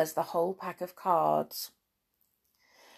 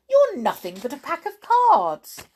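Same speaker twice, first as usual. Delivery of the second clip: high, loud dismissal; defiant outburst; 9 semitones higher, 9 dB louder than usual. A narrator's reading. Alice dismissing queen and court as cards, loud defiance. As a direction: throw it at them loudly.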